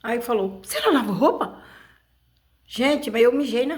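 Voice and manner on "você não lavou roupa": pergunta acusadora, mais aguda e mais alta; mãe brava imitada. Retold or imitated. A mãe cobrando a roupa suja. (voz da mãe brava, acusando)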